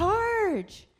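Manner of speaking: loud, high charge cry; shouted charge. Shown as a demonstration. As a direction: yell it like a cheering fan. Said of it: "charge cry again".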